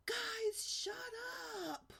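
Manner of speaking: put-on high, loud yell of a frustrated boy; a kid losing it, shouting at his siblings. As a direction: yell it at the top of your voice. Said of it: "as loud as he could".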